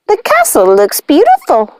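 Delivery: high, light witch voice; Ruby admiring the castle in a high voice. Acted. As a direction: gush over the candlelight.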